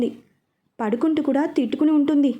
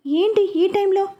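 higher, slower, a bit irritated; slow impatient question, pitched up; 7 semitones higher, 3 dB louder, slower than usual. A narrator's reading. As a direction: woken up and mildly annoyed. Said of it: "అన్నాడు కొంత అసహనంగా".

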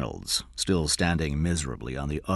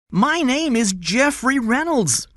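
put-on very high, loud child's voice; slow, high-pitched boyish protest; insistent boy; 7 dB louder than usual. A narrator's reading. Kidnapped boy tells the man his name, insistent.

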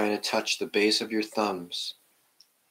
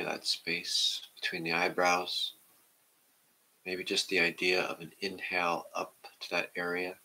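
guided meditation; 2 semitones lower, 4 dB quieter than usual. Acted. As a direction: ease the class into stillness, half whispering. Leads a seated meditation.

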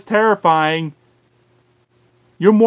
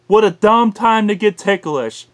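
ordinary talk

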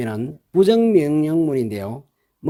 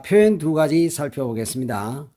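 ordinary talk

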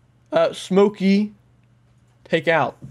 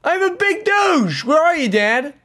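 excited high shout; calling out to someone far off; yelling across the room; 12 semitones higher than usual. Real, not acted. Dad upstairs, kid calling out.

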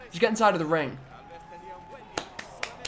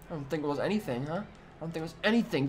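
much softer, offhand taunt; dismissive aside; 2 semitones lower, 9 dB quieter, faster than usual. Acted. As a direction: drop the volume right down, dismissively. Answers own taunt dismissively.

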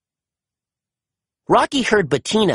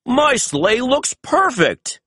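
slow, higher jolly Santa; slow Santa exclaiming; 8 semitones higher, slower than usual. A narrator's reading. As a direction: marvel at the sleigh as Santa, slowly. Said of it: "he exclaimed".

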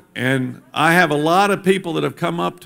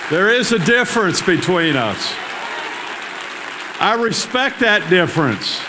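rising preaching; 7 semitones higher than usual. Acted. Governor preaching to the congregation about respecting difference, impassioned. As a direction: preach it, climbing higher each line.

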